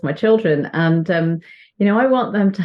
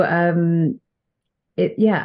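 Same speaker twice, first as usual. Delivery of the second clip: ordinary talk, nothing marked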